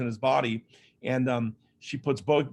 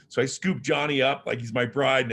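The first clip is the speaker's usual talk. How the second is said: normal talking voice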